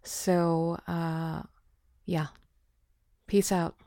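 ordinary talk

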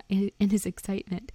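ordinary delivery, nothing marked

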